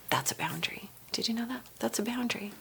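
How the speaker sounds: very soft, near-whispered teaching point; hushed aside. Shown as a demonstration. Coach confiding the lesson to listeners after the script, hushed aside. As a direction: drop to a hush, as if letting them in on it.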